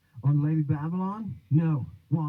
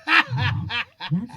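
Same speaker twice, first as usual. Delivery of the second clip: laughing; 8 semitones higher, 4 dB louder than usual. Real, not acted. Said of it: "You're the best".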